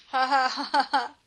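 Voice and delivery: loud, gloating cackle; Henry cackling after the girls run off. A narrator's reading. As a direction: crow over the victory. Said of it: "he cackled".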